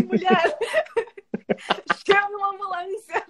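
high laughing plea; laughing exasperation. Retold or imitated. Student in pain begging the nurse, laughing and exasperated. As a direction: beg through laughter.

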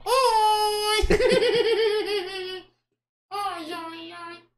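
very high, squeaky puppet greeting with a giggle. Acted. New puppet saying hello to viewers, high puppet voice. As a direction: greet them as a high-pitched dummy. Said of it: "acha uma voz que pareça com o boneco".

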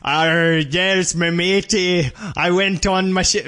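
hammy pirate "Arr". Pretend. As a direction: attempt a pirate, sliding into Scottish. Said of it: "I wanna hear your pirate voice".

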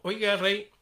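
calling out. Acted. Monk calling to king.

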